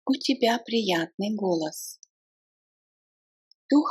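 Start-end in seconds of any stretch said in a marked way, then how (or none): none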